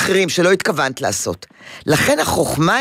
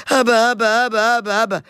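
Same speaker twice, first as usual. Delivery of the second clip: higher whiny call for daddy, easing down; mock crying child; 5 semitones higher, slower than usual. Therapist imitating crying son in his room, mild mock wail. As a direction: wail for dad half-heartedly. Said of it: "ובוכה בחדר".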